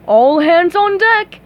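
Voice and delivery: slow booming lifeguard order; heroic lifeguard. Acted. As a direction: announce it like a hero. Lifeguard hero command.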